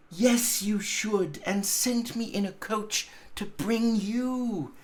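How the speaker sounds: put-on high childlike voice brimming with glee; a child spilling good news; gleeful little girl. A narrator's reading. Little sister telling her brother he can come home, high gleeful child voice. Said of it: "brimful with glee".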